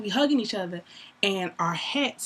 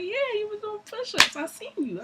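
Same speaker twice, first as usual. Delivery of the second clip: squealing high yeah; high teasing; 13 semitones higher than usual. Retold or imitated. Teasing him about push-ups, squealed high.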